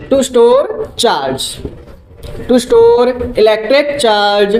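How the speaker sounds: very slow, word-by-word dictation; slow note-taking pace; dictating slowly. Shown as a demonstration. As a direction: spell out the definition so students can write it. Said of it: "slow dictation of definition".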